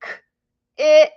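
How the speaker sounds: K and it split into separate sounds; sounding out. Shown as a demonstration. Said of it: "we sound it out".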